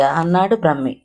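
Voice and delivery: plain reading voice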